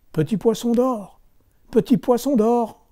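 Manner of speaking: high call across the water. Acted. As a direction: call the fish from the shore. Fisherman calls fish over sea.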